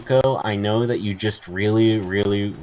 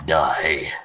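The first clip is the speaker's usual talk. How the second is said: lightly put-on villain voice, lower on the first word; mock menace; 6 semitones lower, much slower than usual. Player dooming a game opponent in a mock villain voice. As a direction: pretend doom pronouncement.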